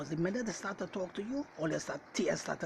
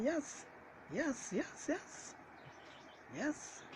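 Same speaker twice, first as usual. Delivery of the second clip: high, soft repeated yeses; rising up high as he insists; emphatic yes; 10 semitones higher, 7 dB quieter, much slower than usual. Real, not acted. Preacher affirming his own point.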